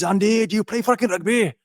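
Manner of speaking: high, hammed-up Welsh bark; bad Welsh accent. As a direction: do the worst Welsh accent you can. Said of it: "this is the worst Welsh accent in the world".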